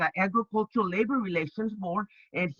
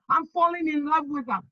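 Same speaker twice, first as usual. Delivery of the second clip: giddy excitement; 8 semitones higher than usual. Retold or imitated. Repeating the call to mom.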